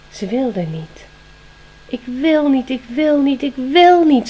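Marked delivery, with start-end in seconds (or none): 1.9-3.4 higher, louder protesting chant; inner cry. Acted. Spring cries out inside that she won't accept it, loud. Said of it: "schreeuwde het van binnen". 3.4-4.3 even louder, high, falling wil; inner scream. Acted. Spring screams inside against losing her papa. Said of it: "schreeuwde het van binnen".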